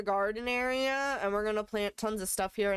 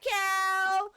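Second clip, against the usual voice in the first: excited cow; 10 semitones higher, 4 dB louder than usual. Real, not acted. Delight at cow.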